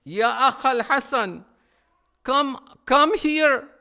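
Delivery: loud, high wailing call, slowed on the bad news; anguished scream. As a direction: cry out to his brother over their mother's body. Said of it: "he screamed and he said".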